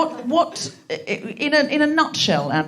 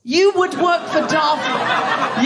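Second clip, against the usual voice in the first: high, drawn-out mock horror; slow it down and pitch it up in disbelief; incredulous outburst; 4 semitones higher, much slower than usual. Real, not acted. Comedian host to corporate lawyer switching sides, incredulous.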